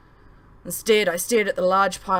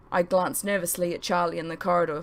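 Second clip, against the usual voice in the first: ordinary delivery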